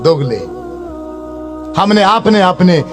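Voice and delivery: ordinary talk, nothing marked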